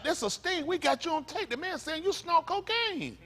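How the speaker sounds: sing-song gotcha from the agents; mock FBI sting. Agents springing the sting on Bill, high mock gotcha. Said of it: "They were like".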